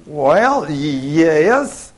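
drawn-out, faltering yes; hesitant. Retold or imitated. Coworker starting to doubt she feels fine, hesitant. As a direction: imitate someone unsure, stumbling over the answer.

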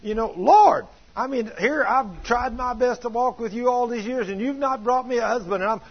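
high-pitched, loud complaint to God; fussing angrily. Retold or imitated. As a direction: act out her exasperated tirade at God. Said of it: "I was just fussing at God".

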